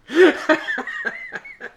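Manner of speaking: shrill burst of laughter; high squealing laugh. Real, not acted. Laughing at own story.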